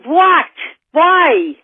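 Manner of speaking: sudden loud high "What? Why?"; shocked. Retold or imitated. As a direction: blurt it out, startled. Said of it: "And she said".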